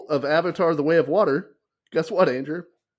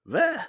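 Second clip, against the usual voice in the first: dismissive veh; 13 semitones higher than usual. Pretend. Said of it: "I'm like".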